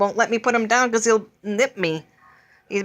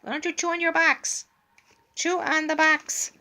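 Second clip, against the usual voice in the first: high coaxing pet voice; 7 semitones higher than usual. Real, not acted. Coaxing the bird to chew.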